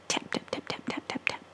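soft whispered tap, tap; whispered tapping. A narrator's reading. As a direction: tap it out softly. Tapping boots sound.

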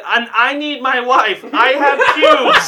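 slow, high badly faked African accent; poor African accent. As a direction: insist slowly in a hammed-up African accent. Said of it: "In the poorest African accent I can fucking muster".

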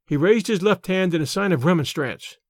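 usual speaking voice

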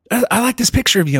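high, quick quote of the stylist; stylist, no accent. Retold or imitated. Stylist praising the photo, lightly voiced and high. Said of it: "I'm not gonna try to do the accent".

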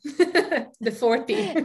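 slow, laughing through the words. Real, not acted. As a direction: let the laugh carry "the forty". Laughing about turning forty.

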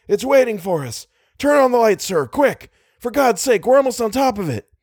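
high-pitched, urgent orders; frantic cry. A narrator's reading. Driver begs for the light before impact, frantic. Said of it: "cried Little".

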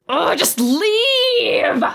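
high, drawn-out exasperated cry; exasperated outburst. Acted. Kicking everyone out of the recording studio, exasperated cry. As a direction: stretch it out high, at the end of your patience. Host throws noisy crowd out.

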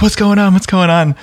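high, startled imitation of the waking wife; alarmed wife, imitated. Wife wakes to crying baby, alarmed high voice. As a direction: play the wife jolted awake, voice jumping up. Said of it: "she wakes up like".

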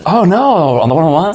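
high, exaggerated Californian dismay; California accent. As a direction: play a shocked comic Californian. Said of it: "You do have a very good one".

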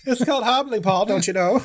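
put-on high Minnesota accent, laughing through it; giggling Minnesota Annie voice. Host quotes Annie, laughing Minnesota accent. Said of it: "this Minnesota accent".

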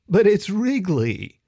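slow, high, loud mocking; taunting. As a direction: sing out the nickname to needle. Girl taunting.

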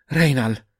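the name gasped out, out of breath from running; breathless call. A narrator's reading. Friend arriving after running, breathless. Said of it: "ripeté senza fiato".

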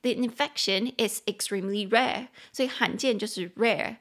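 ordinary talk

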